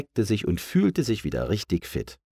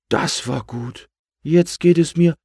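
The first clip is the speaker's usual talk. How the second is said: higher, louder, pleased balloon voice; relieved balloon; 8 semitones higher, 6 dB louder than usual. A narrator's reading. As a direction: pipe up with relief as you fill with air. Relieved balloon cut off while inflating.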